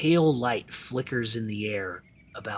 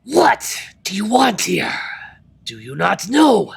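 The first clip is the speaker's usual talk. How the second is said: loud high spectral voice; dark lord; 9 semitones higher, 7 dB louder than usual. Acted. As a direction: threaten like an angry banshee. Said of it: "she snarls".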